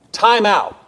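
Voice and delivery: sudden high, loud call to stop; abrupt loud exclamation. Retold or imitated. Preacher quoting R C Sproul to the congregation, abrupt and loud. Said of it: "abruptly, loudly".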